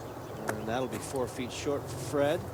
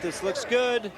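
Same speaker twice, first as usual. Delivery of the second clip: higher, louder rising hope; excited; 8 semitones higher, 6 dB louder, slower than usual. Real, not acted. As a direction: call it as the putt tracks in. Putt tracking toward hole.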